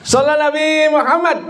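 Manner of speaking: high-pitched call to the congregation; salawat call. Real, not acted. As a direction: call out high for the crowd's response. (seruan selawat bernada tinggi)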